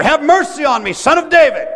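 very high, loud desperate cry. Retold or imitated. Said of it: "He cried all the louder".

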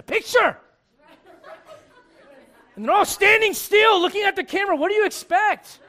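loud, high-pitched exasperation; exasperated shout. Acted. Preacher answering envy of happy family photos, exasperated shouting. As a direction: burst out as if it's obvious.

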